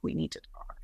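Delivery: hushed, whispered line. Retold or imitated. Singer cornering her at the bar, whispering. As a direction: lean in and whisper it. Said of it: "he was like".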